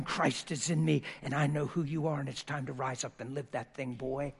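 ordinary delivery

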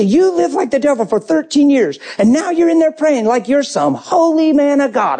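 mock the would-be holy man; scornful quote. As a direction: throw it as a scornful accusation. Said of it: "She'd say".

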